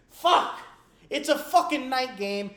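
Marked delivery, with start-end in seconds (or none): none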